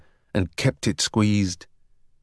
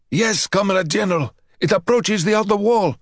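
higher, dutiful soldier voice; guard reporting; 9 semitones higher than usual. A narrator's reading. Marksman in the tower reporting to the general. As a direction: report up smartly.

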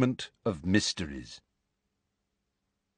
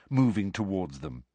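ordinary delivery, nothing marked

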